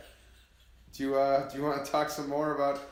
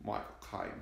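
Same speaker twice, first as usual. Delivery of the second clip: softer, lower British attempt; understated mock British; British accent; 4 semitones lower, 9 dB quieter than usual. Shown as a demonstration. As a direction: toss off the name in a British accent. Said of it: "Michael Caine in a British accent".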